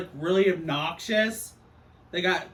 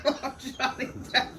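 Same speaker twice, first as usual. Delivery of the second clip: laughing; 5 semitones higher than usual. Real, not acted. Laughing while gushing.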